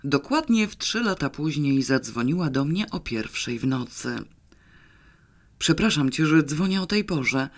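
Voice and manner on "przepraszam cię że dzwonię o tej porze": plain reading voice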